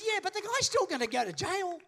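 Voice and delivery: high acted objection; incredulous protest. Friend objects to the lawyer's calm verdict, incredulous. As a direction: protest in mock disbelief.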